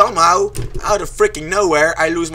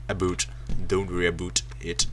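mock Canadian accent; 11 semitones lower, 10 dB quieter than usual. Plays with accent on about.

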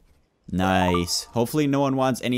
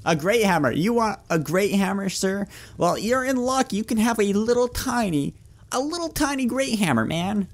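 much higher, cartoonish merchant voice; squeaky shopkeeper; 7 semitones higher than usual. Shopkeeper offering a tiny hammer to a customer, squeaky and high. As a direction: sell it like a mocking little shop clerk.

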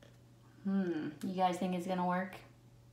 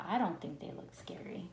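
hushed thinking-aloud whisper; 3 semitones lower, 8 dB quieter than usual. Acted. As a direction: whisper the ninja's thought. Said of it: "the ninja's thinking".

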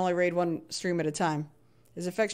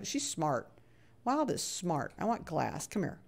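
normal talking voice

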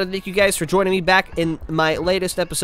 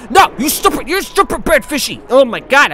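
loud, high, fed-up outburst; exasperated scolding; 7 semitones higher, 7 dB louder than usual. Real, not acted. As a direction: scold the creature loudly. Yelling at the game fish.

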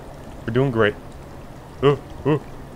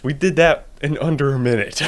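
usual speaking voice

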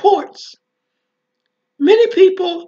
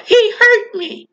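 hurt cry; 5 semitones higher, 3 dB louder than usual. Retold or imitated. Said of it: "I would feel anger welling up inside of me".

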